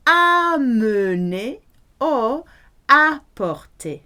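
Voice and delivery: amener and apporter pronounced slowly and loudly in French; careful French demo of amener, apporter; slow clear French. Shown as a demonstration. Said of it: "To bring is either".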